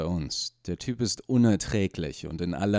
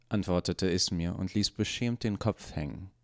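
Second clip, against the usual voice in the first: plain reading voice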